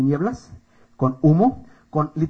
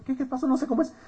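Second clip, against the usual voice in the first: higher, soft innocent questioning; clueless naif; 6 semitones higher, 7 dB quieter than usual. Retold or imitated. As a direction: play yourself as the gullible friend. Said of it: "Yo bien baboso, ¿no?". (ingenuo baboso, imitado)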